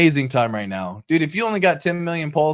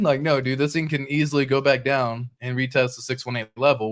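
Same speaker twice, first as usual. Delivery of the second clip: normal talking voice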